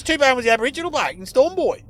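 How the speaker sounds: high, loud, excitable talkback caller; caller impression. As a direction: pitch it up like an over-keen radio caller. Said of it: "he sounded like one of your code".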